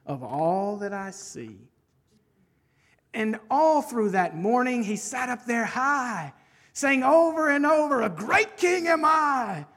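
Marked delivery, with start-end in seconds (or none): 3.1-8.0 animated rhyming story narration; bouncy picture-book storyteller; story reading. Acted. Reads a rhyming children's book aloud. 8.0-9.7 higher, slow royal boast; self-satisfied little monarch; boastful king. Acted. King boasting in a read story.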